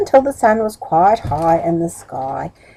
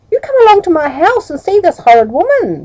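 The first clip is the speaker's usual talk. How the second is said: begging; 10 semitones higher, 5 dB louder, faster than usual. Acted. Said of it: "begged Middle".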